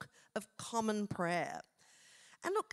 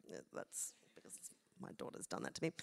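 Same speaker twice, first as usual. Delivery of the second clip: whispered aside; 7 semitones lower, 11 dB quieter, faster than usual. Real, not acted. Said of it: "aside about daughter".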